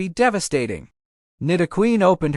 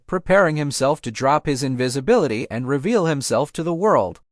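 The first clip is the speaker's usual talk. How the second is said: ordinary delivery, nothing marked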